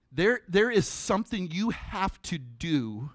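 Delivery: ordinary delivery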